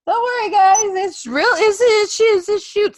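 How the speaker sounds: high, loud mock announcement; mock reassurance. Reassuring the fans about the shoot son, hammed up.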